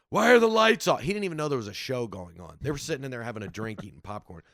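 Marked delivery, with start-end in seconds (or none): 0.1-1.0 put-on high, loud bewildered old man; confused old man. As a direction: holler it like a baffled old-timer. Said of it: "The old man's like".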